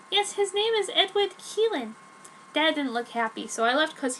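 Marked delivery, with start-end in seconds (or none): 0.1-1.9 put-on higher girlish Joan voice; Joan's voice. Teen girl answering her dad in higher voice. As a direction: pitch her up as the teen heroine.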